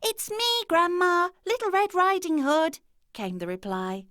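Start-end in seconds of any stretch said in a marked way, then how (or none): 0.0-2.8 put-on high, bright child calling through the door; little girl voice. A narrator's reading. Girl at the door announcing herself, high child voice. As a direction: pitch it way up like a small girl.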